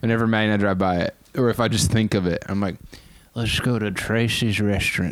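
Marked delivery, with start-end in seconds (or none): 3.3-5.1 put-on gummy old-person voice, no teeth; toothless old person. Shown as a demonstration. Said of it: "very old person with no teeth".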